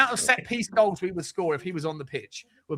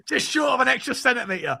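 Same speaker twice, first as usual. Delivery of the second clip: laughing; 11 semitones higher, 4 dB louder than usual. Real, not acted. Laughing tease about height.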